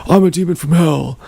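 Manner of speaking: put-on cocky demon voice; super confident guy. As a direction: proclaim it like a self-assured show-off, imitating. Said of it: "this guy who was super confident".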